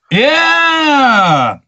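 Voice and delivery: loud, high whoop of joy; celebrating shout; excited shout. Real, not acted. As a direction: cheer it out loud. Excited about his commercial airing.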